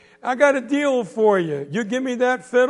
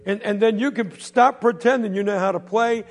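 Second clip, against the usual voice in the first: loud jeering; 4 semitones higher, 7 dB louder than usual. Acted. Bully hollering at the boy walking home, loud mockery. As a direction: holler the insult across the street.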